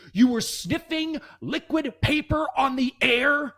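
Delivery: high, slow, disbelieving; incredulous principal. Retold or imitated. Principal confronting the student, slow high disbelief. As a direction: stretch it out in outraged disbelief. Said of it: "He goes".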